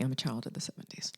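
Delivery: fast confiding whisper; a quick hushed admission; whispered aside. Real, not acted. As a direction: let it slip like a secret. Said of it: "I say groovy".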